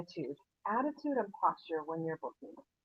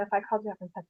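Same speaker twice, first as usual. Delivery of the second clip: ordinary delivery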